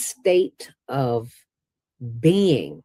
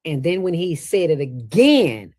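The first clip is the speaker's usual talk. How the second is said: usual speaking voice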